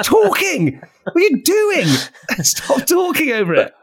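very high-pitched exasperated scolding; comic outrage. Acted. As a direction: squeal it in hammed-up disbelief. Acting out outrage at talking fiancée.

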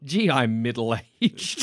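slow, low mock self-pity; mock film line. Mocking Bridget Jones's Baby dialogue, slow and low.

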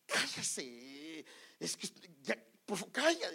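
mock telling-off, rising to loud; imitated scolding. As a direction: play an exasperated crowd hushing someone. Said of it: "le regañaban para que callara". (regaño imitado que sube de volumen)

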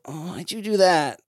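lightly voiced groggy goblin; woken goblin. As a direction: grumble as if kicked out of sleep. Goblin woken by a kick complains.

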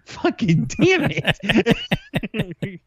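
laughing curse. Real, not acted. Laughing frustration.

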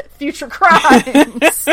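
laughter overtaking the sentence's end; laughing. Real, not acted. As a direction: crack up on the last words. Cracks up at own joke.

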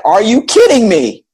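high-pitched incredulous protest; retold disbelief. Retold disbelieving reaction to mom.

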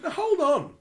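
exasperated demand. Pretend. Retorting to pundit dodging question.

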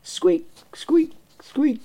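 high squeaky mouse noises; mouse squeaks. Pretend. Narrator voicing the confused mice, high squeaks. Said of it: "squeaked in confusion".

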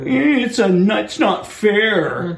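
high nasal-free whine, slowed; mock a sniveling complainer; whiny voice.

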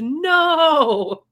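hammed-up onlookers' scream; mock scream. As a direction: cry it out long like a crowd retold. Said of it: "onlookers all screamed".

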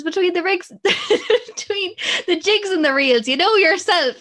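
high, loud quote breaking into laughter; hammed-up sociable patter through giggles; Mum's chirpy reply. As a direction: imitate Mum's breezy stock phrase, laughing. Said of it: "And she says".

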